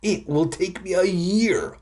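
high, complaining moan. A narrator's reading. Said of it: "I moaned".

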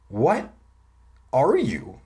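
halting, word-by-word question; asking slowly. A narrator's reading. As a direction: leave a long gap after the first word. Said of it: "I asked slowly".

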